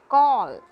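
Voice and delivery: "call" loud, with a long "aw" vowel; pronunciation model. Shown as a demonstration. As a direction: model "call" firmly for learners. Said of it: "let us see in second example".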